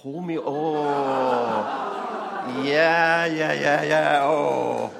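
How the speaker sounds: loud, slow, drawn-out yeahs; loud over-the-top delight at wholemeal bread. Real, not acted. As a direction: stretch out loud mock delight. Mock delight at bread answer.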